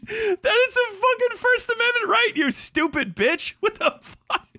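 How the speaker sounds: ordinary talk, nothing marked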